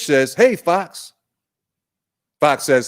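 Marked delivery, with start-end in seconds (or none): 0.3-1.1 higher, bright-sounding fish voice greeting back; cheerful fish reply. Acted. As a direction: answer like a friendly little fish. Fish answers fox in story.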